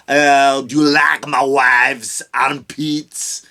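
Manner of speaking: slow, loud, hammed-up French accent; mock French voice. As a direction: drag it out like a smug Frenchman showing off. Mocking the French boasting about Paris.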